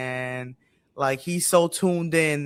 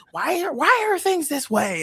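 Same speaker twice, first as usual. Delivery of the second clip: mocking newcomer; 11 semitones higher than usual. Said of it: "and they're like".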